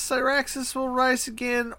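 high, manic cultist chant; crazy cultist. Shown as a demonstration. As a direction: rant it like a crazed zealot. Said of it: "is he saying it like crazy cultist".

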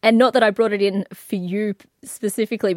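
usual speaking voice

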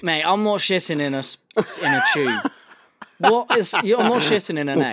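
high, loud disbelief; incredulous outburst. Real, not acted. Friend reacting to toilets shaped like eggs, loudly incredulous. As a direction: protest loudly in real disbelief.

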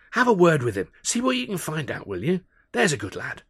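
lightly voiced fatherly coaxing; Alf voice. A narrator's reading.

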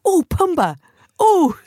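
put-on high, loud childish squeal of delight; loud high squeal, like kids spotting Pumbaa. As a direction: squeal at the warthog like a thrilled kid.

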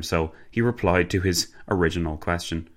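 ordinary delivery

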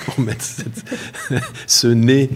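words broken up by chuckles; amused laughing. Real, not acted. Explaining the Cyrano nose on the cheese label, laughing. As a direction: laugh your way through the words.

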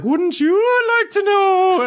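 very high, loud mock-spooky wail; silly ghost voice. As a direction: wail it like a hopeless haunter. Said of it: "work on that fucking voice".

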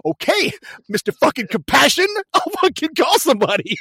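high, loud exasperated snap; hammed-up fed-up gangster; annoyed Chris Penn. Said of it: "Chris Penn is like".

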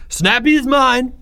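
high, loud, drawn-out claim; triumphant proclamation. A narrator's reading. Greg answers cheers after tearing the note, triumphant. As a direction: proclaim ownership to a cheering crowd.

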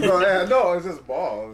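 laughing loudly while answering; bursting out laughing mid-sentence. Real, not acted. Laughs after Superman question jab.

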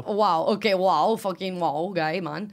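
loud, hammed-up Italian accent; Mark's Italian accent. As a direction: belt out wow like an Italian immigrant friend. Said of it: "in his immigrant Italian accent".

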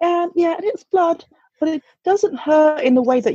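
high, careful mock-soothing mum voice; mum explaining delicately. Mum explaining blood to her daughters.

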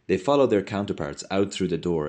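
plain reading voice

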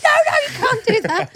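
high, squeaky laughing protest; mock alarmed protest. Drive-through staff at boy through window, high mock protest. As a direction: imitate flustered staff, giggling through it.

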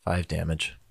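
low, soft and underwhelmed; unexcited. Real, not acted. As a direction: report it with no excitement at all. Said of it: "The excitement in your voice".

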